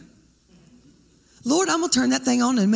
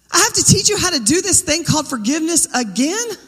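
high, exasperated, each again pushed up; a fed-up voice asking why this again; exasperated scolding; 6 semitones higher than usual. Pretend. Imagined exasperated teacher, repeated again.

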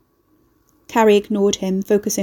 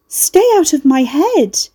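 higher, louder, slow and pleading; frightened shout; 7 semitones higher, 6 dB louder, slower than usual. A narrator's reading. Carrie shouting at Fairwood to get out of her head, loud and slow. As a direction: shout to push the voice out of your head.

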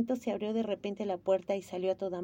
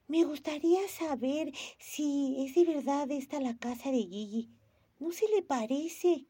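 put-on high, slow, wondering girl voice; a small girl unsure at the gate; child Momo; 5 semitones higher than usual. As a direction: Momo asking the tortoise, higher and slower. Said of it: "dijo Momo".

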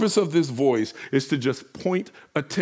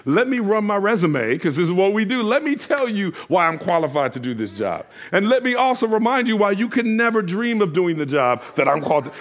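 mock defensive; 6 semitones higher, 4 dB louder than usual. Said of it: "it can be very defensive".

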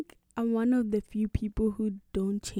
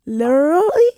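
American accent; 10 dB louder than usual. Shown as a demonstration. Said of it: "a spot on, like, a American".

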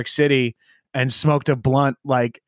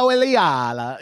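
slow, stretched mock-Italian name; over-the-top Italian accent; 2 semitones higher, slower than usual. Host showing off on guest's name, over-the-top Italian accent. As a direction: overdo the Italian on every vowel. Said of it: "you try to make it super".

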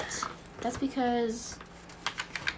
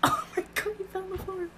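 laughing whisper; 9 semitones higher, 11 dB quieter than usual. Real, not acted. Laughing at someone falling.

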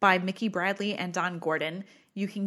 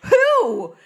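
surprised; 8 semitones higher, 11 dB louder than usual. Retold or imitated. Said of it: "I was like".

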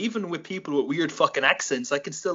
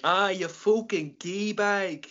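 put-on slow, higher-pitched Dublin accent; Dublin slang insult; 5 semitones higher, slower than usual. Irishman demonstrating slang, drawn-out Dublin accent. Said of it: "people in Dublin say".

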